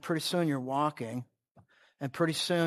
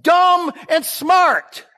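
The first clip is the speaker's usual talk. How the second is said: loud, high-pitched sermon yell with stretched key words; fervent preaching; 10 semitones higher, 10 dB louder than usual. Real, not acted. Preacher yelling to the congregation and prisoners for amplification, fervent preaching. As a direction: preach it at full force, hammering each point.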